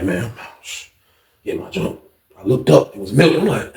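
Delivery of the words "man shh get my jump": hushed whisper under the breath. Retold or imitated. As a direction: whisper so nobody hears. Sneaking up while cooking in cell.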